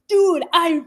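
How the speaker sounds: high, gushing mock pitch; hammed-up breathless enthusiasm of a convert; overexcited seller. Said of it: "We're just coming".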